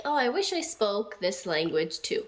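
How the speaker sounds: ordinary talk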